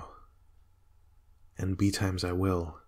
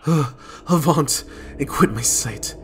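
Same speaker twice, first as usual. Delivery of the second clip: loud high cry of terror at the ghost; terrified outcry; 7 semitones higher, 8 dB louder than usual. Acted. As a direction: shout the ghost away in horror. Macbeth sees Banquo's ghost.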